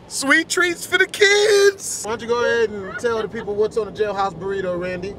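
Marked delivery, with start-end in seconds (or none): 0.1-2.0 high, slow announcer voice; mock cooking show host. Host pitching Auntie Randy's cooking show, slow and high. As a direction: sell it like a kids' TV cook.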